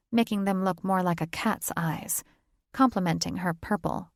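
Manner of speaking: ordinary delivery, nothing marked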